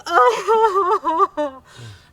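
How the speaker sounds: loud high fake sob; hammed-up wailing sob. Wife sobbing after calling him dead, loud high mock sob. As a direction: burst into mock sobs. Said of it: "she carried on crying".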